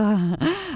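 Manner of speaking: put-on short high drunken laugh; brief acted boozy giggle; tipsy chuckle. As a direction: let a laugh slip out. Drunk Sophie laughs about lover.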